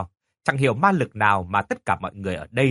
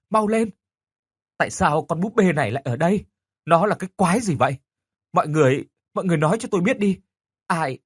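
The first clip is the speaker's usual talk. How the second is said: shouting; 5 semitones higher than usual. Acted. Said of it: "nghe tôi quát lên".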